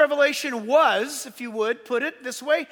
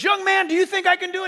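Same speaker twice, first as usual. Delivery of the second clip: put-on stuntman asking young man; 6 semitones higher, 4 dB louder, faster than usual. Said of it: "And he goes".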